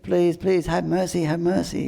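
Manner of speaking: high, pleading cry for mercy. Retold or imitated. Man beaten in a dream begs the deity, high pleading. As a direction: beg desperately for mercy, voice up high.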